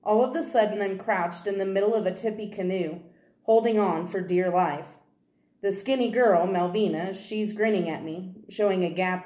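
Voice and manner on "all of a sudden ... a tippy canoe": ordinary delivery, nothing marked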